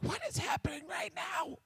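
high, breathless whisper of disbelief; stunned whisper. Acted. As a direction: whisper it, frozen in shock. Son stunned by running father.

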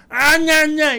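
put-on high whiny baby noise; mock whine. Mocking people who whine when they haven't eaten. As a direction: whine like a fussy child. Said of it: "no soporto esa gente de que".

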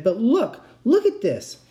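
urgent, high and pushing; excited. A narrator's reading. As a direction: shove the news at him. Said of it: "I waved the phone".

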